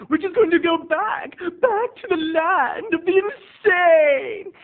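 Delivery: high, wailing, drawn-out announcement; eerie high proclamation with a long final wail; theatrical spooky host. Acted. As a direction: cry out the segment title like a crazed horror host. Theatrical segment title again.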